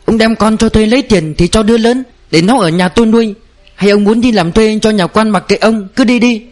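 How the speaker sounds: a defiant wife shrieking at her husband; wailing yell. A narrator's reading. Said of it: "Lúc này bà gào lên".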